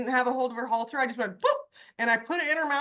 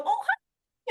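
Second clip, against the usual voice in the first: much higher, sing-song innocent greeting; faux-innocent hi. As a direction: play dumb, breezy and high. Sneaking dewormer past horse, faux innocent.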